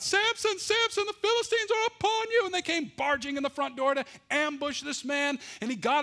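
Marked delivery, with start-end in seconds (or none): 0.0-3.9 high, loud cry of alarm; yelled alarm. Acted. Wife yelling to wake Samson, loud and high-pitched. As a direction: shout his name as an alarm. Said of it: "she yelled out".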